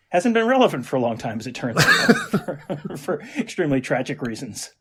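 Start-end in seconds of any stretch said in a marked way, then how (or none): none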